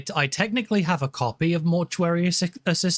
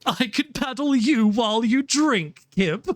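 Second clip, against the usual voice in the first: higher, teasing put-on voice; playful mock threat; 9 semitones higher than usual. Butler act teasing a chat member, playful mock threat. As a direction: lift the pitch and tease, all in play.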